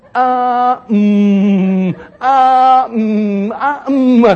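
loud, high, drawn-out ums and ahs; exaggerated hesitation noises. Shown as a demonstration. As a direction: mimic a speaker stalling noisily. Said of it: "Those are unnecessary noise in your talking".

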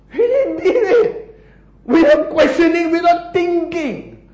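high, loud disbelief; incredulous exclamation. Real, not acted. Preacher appalled Jehoshaphat obeyed without thinking, incredulous. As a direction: exclaim it high, drawing out the first words.

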